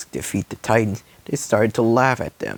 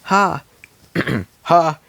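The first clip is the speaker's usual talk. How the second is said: high, loud taunting ha; mocking villain laugh; 7 semitones higher, 5 dB louder than usual. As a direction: taunt the hero.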